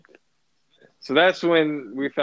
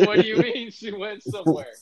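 higher, sharper repeat of the question; incredulous repeat; 6 semitones higher than usual. Retold or imitated. Repeating the question to Adam, higher insistent disbelief. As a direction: press him again, more insistent. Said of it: "I'm like".

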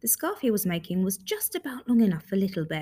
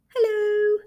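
high, louder, muffled from inside a box; muffled reply; 12 semitones higher, 6 dB louder than usual. Acted. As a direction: call out as if shut inside a box. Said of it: "came the muffled reply".

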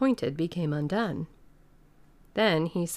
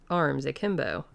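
usual speaking voice

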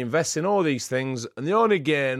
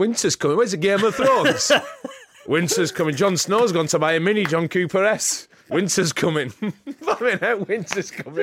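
laughing mock drama; 6 semitones higher, 5 dB louder than usual. Real, not acted. Mocking Game of Thrones line, laughing.

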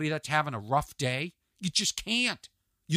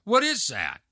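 higher, mock-baffled retort; incredulous question; 4 semitones higher than usual. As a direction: ask it in puzzled disbelief. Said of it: "That's how I said it".

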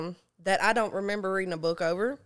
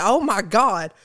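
retold panic, louder; freaking out; 7 dB louder, slower than usual. Storyteller recalls foaming at the mouth, freaking out. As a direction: cry out in mock alarm. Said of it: "I started freaking out".

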